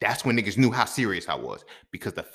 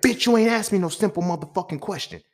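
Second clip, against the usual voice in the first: loud, high cussing snap; angry retort; 11 semitones higher, 7 dB louder than usual. Retold or imitated. Snapping at the lady at the car, loud and angry. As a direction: bite back loud and furious. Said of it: "I said".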